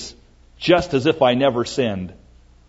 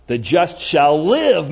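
"live" raised and drawn out; stress on LIVE; 3 semitones higher, slower than usual. Shown as a demonstration. Preacher showing the emphasis in Galatians, drawn-out stress on "live". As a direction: stretch "live" slowly, with force. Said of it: "the emphasis is on shall live".

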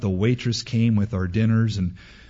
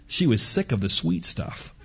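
normal talking voice

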